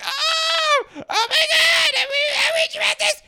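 very high fast shrieking parody; squealing mock echo. Mocking herself echoing outrage, very high fast squeal. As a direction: shriek it back in mock panic. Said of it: "And I'm like".

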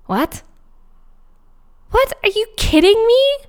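high-pitched disbelief after stunned pauses; stunned questions leaping up high; incredulous. Retold or imitated. Reacting to her friend's invitation, incredulous. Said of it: "looked at her and I was like".

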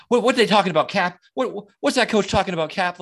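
a hint of a clueless private asking his captain; Loudon Downey impression. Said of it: "a P F C Loudon Downey from".

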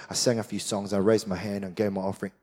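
low, soft, quick rattle-off; mock box-ticking. Pastor mocking churchgoers ticking boxes, soft and quick. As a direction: rattle it off dismissively. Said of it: "tick the box".

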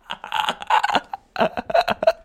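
long breathless laugh; helpless laughter. Real, not acted. Streamer cracking up at absurd advice, wheezing laugh.

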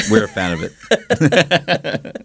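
said through a laugh; teasing about Jerry's flowery words while laughing. Real, not acted. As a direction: laugh it out, drawn out slowly.

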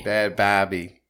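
put-on Boston accent, dropped r; New England accent. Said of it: "you gotta say it with that New England accent".

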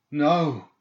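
sharp, high, loud gasp of a word. A narrator's reading. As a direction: gasp it out in alarm. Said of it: "he gasped".

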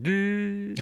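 high drawn-out noise for a dumb mascot face; mock mascot noise; goofy frozen-face noise. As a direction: imitate a glued-on grin in one held high sound. Imitates the glued-on mascot face.

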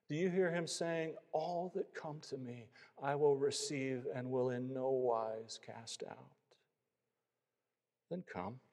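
gentle, pleading altar call; preacher's tender appeal. Real, not acted. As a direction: lilt the questions upward, then slow to a hush. Pleads with listeners to come to faith.

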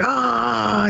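hammed-up scream at no great volume; retold scream. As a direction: draw out a high "aah" in imitation. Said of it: "He just starts screaming".

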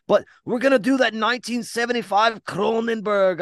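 high, hammed-up Scottish brogue; bad Scottish accent. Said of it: "trying my best to do Scottish".

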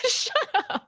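laughing high shut up; laughing shut up at a friend. Real, not acted. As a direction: squeal it through laughter. Laughing retort.

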